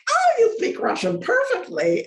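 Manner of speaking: high, gushing mock astonishment; hammed-up impressed reaction; imitated amazement. Imitates amazed Russian speakers' reaction.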